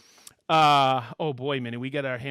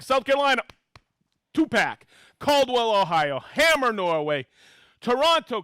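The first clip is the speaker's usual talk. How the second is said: upbeat, louder roll-call of hometowns; excited shout-outs; 6 semitones higher, 6 dB louder than usual. Acted. Host reading viewer hometowns to the live audience, excited and loud. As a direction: call each town out like a game-show host.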